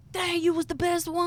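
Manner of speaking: high, impressed praise; admiring crowd. Retold or imitated. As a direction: praise her like a fan. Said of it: "they be like".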